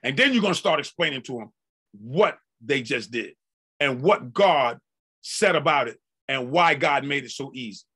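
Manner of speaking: ordinary talk, nothing marked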